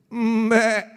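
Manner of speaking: short bleating "mbek" like a goat. Acted. Preacher bleats like a goat for the crowd, mock bleat.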